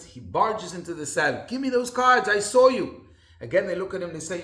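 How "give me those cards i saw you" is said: loud, higher demand; a guard storming in, loud and higher; adamant guard. Retold or imitated. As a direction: bark the order at them.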